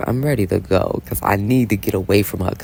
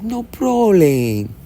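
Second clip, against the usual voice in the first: high, drawn-out gangster impression; hammed-up, slow film-villain accent; Scarface; 9 semitones higher, much slower than usual. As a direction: stretch it out in a put-on movie gangster voice. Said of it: "In my Scarface".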